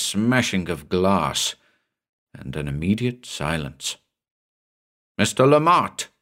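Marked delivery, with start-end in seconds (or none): 5.2-6.1 higher-pitched, moderately raised calling-out; shout. A narrator's reading. Narrator calling the mate into darkness, contained shout. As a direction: call urgently for the missing mate. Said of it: "I shouted".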